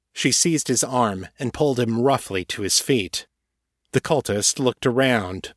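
ordinary delivery

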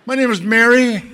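imitating a giggly caller; eager young fan. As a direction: say your name bubbling over. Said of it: "Who are you?".

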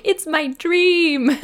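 put-on high, loud, slow wail of an upset little boy; crying child. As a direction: wail it like a heartbroken six-year-old. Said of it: "he's crying and he's like".

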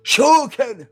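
Shioken shouted high and loud like an anime attack; hammed-up high echo. Joining the joke, shouting Shioken as an anime attack name. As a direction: yell Shioken like a special move. Friends mocking the pronunciation.

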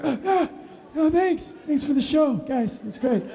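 high, strained wreck of a voice; imitating a voice lost from screaming; voice blown out. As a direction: squeeze out thanks with no voice left. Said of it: "afterwards, I couldn't talk".

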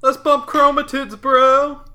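high, loud dude-bro cheer. Pretend. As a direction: hype it up like a frat bro. Chromosomes giving high fives.

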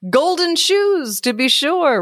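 high, slow beetle voice. A narrator's reading. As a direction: lift the beetle's voice up high and stretch it. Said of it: "replied the beetle".

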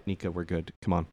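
muffled behind a cupped hand; hand over mouth. Acted. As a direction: speak into your hand, hushed. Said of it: "like, speak into my hand".

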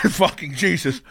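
normal talking voice

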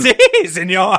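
put-on cackling Puerto Rican accent, high and loud. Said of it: "Midge cackled in a thick Puerto Rican accent".

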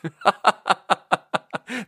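high chuckling laugh; burst of laughter. Real, not acted. Laughing after telling off a coworker, amused laughter. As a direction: crack up at your own rant.

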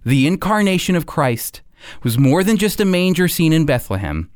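usual speaking voice

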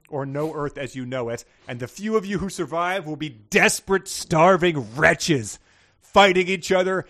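building to a loud doom-laden cry; ominous prophecy. Acted. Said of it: "He says".

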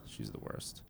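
hushed unvoiced aside; whispering. Real, not acted. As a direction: whisper it under your breath.

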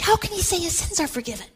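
high, indignant protest; scandalized pharisee crying blasphemy, pitched high; outraged. Acted. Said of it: "They were outraged".

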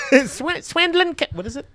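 high, loud squeaky voice. Acted. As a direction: squeak it out comically. High put-on voice mangling names.

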